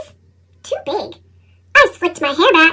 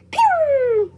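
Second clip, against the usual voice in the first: spitting sound effect; 6 semitones higher, 5 dB quieter than usual. Acted. Seed spit out, sound effect.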